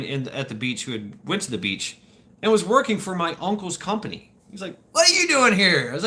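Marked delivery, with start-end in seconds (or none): 4.9-5.9 loud, high-pitched amazement; surprised greeting. Retold or imitated. Friend bumping into him at the beach, loudly surprised. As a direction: call out in acted astonishment.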